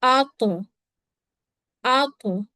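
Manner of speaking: आक with the final consonant bounced, echoing back; qalqala echo. Shown as a demonstration. Teacher demonstrating qalqala on tva to students. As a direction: let the end of आक rebound with an echo. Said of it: "त्वा भी हरूफे कलकला".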